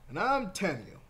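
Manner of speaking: mild southern US lilt; southern accent. Acted. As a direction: introduce yourself with a light southern twang. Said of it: "giving her a southern accent".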